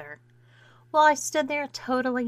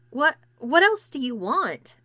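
higher, louder exasperated question; 6 semitones higher, 4 dB louder than usual. A narrator's reading. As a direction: throw up your hands at the demand. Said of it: "asked an exasperated Clarissa".

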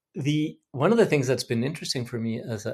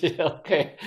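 laughing; 3 semitones higher, slower than usual. Retold or imitated. Laughing while quoting own reply.

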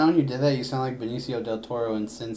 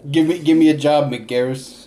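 loud, higher begging; mock begging; 3 semitones higher, 8 dB louder, slower than usual. Friend pleading with director for a job, loud and higher. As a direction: beg the director for work.

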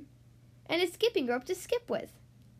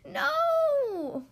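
high, loud cry of protest; children protesting to the headmistress; children shouting; 11 semitones higher, 4 dB louder than usual. A narrator's reading. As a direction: shout it out like a crowd of kids. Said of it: "All the girls and boys shouted".

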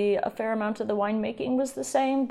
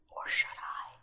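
teasing whisper; 12 dB quieter than usual. Acted. Teasing innuendo aside.

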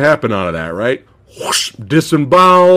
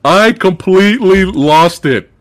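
ordinary talk, nothing marked

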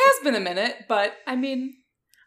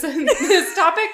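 laughing; 11 semitones higher, 6 dB louder, much slower than usual. Real, not acted. Laughing line.

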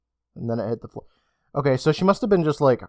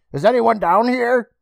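higher, louder call; 9 semitones higher, 5 dB louder than usual. Acted. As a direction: call out into the basement. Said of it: "she called again".

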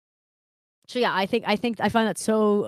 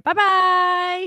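high cutesy goodbye; 10 semitones higher, 7 dB louder than usual. Acted. Cutesy sign-off.

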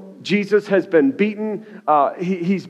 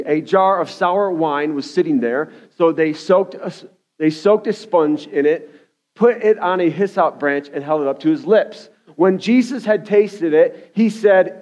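ordinary delivery

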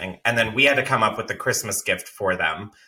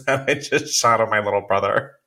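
ordinary delivery

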